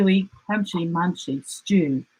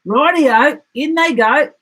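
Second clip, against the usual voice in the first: higher and louder, eager; 7 semitones higher, 6 dB louder than usual. Acted. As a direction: jump at the idea. Dingo eager agreement.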